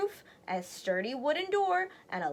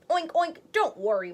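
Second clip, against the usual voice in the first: higher piggy voices with oinks; oinking pigs; 4 semitones higher, 4 dB louder than usual. Acted. Three pigs reassure their mother, oinking cheerfully. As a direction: oink it out cheerfully like little pigs. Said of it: "they oinked".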